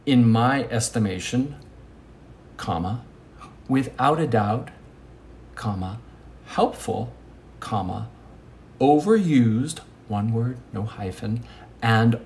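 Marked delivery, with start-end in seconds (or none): 2.6-3.1 "comma" said low and soft, like a pause mark; spoken punctuation. Shown as a demonstration. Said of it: "Commas are". 5.6-6.0 "comma" said low and soft, like a pause mark; spoken punctuation. Shown as a demonstration. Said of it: "Commas are". 7.6-8.1 "comma" said low and soft, like a pause mark; spoken punctuation. Shown as a demonstration. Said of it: "Commas are".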